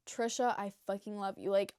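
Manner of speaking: ordinary talk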